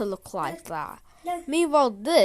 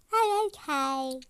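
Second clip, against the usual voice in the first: usual speaking voice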